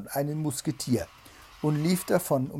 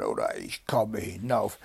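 lower, rough-edged Bluebeard threat; shouted threat; 5 semitones lower than usual. A narrator's reading. Bluebeard threatens to come upstairs, shouted threat. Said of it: "schrie Blaubart".

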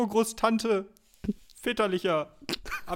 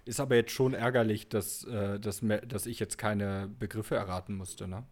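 much lower put-on voice; disguised deep voice; 13 semitones lower, 7 dB quieter than usual. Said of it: "Ich hab sogar meine Stimme verstellt".

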